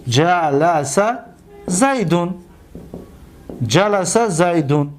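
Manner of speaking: very slow, low; جلس drawn out, ja-la-sa; slow demonstration. Shown as a demonstration. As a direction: stretch جلس for students. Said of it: "دقت بتید،".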